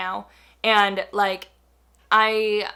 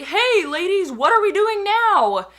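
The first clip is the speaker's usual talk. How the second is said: higher, louder imitation of a pushy stranger; creepy guy; 8 semitones higher, 5 dB louder, slower than usual. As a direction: mimic his too-friendly greeting.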